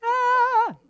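high, short hammed-up scream of fright; sudden high cry of terror; acted scream. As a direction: let out a mock shriek.

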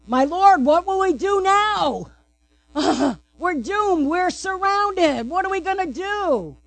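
high, pleading panic as the frightened servant; panicked servant. Acted. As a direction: beg your master for help, voice climbing. Servant panics before Elisha.

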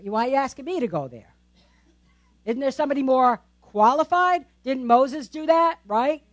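ordinary delivery

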